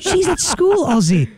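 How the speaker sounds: put-on a child correcting a muddled dad; high kid voice explaining gently; mock kid answering.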